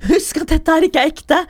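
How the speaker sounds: high, loud scolding; retold telling-off. Best friend loudly orders the scared friends to calm down. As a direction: snap them out of their panic.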